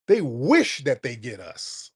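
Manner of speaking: ordinary delivery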